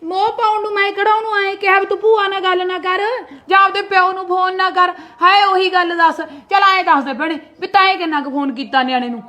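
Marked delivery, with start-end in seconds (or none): 0.0-8.2 much higher and louder, outraged; indignant retort. Acted. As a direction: shoot back in disbelief at the accusation. Quarrel, indignant reply. (ਉੱਚੀ ਤਿੱਖੀ ਆਵਾਜ਼ ਵਿੱਚ ਹੈਰਾਨ ਗੁੱਸਾ)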